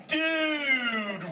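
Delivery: high, stretched-out surfer holler; loud, drawn-out turtle yell; really loud. Shown as a demonstration. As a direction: belt it out for the crowd to copy. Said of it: "really loud".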